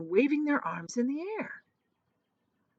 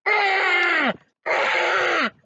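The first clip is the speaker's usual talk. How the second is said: furious shriek; 10 semitones higher than usual. A narrator's reading. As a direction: shriek with fury. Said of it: "shrieking with fury".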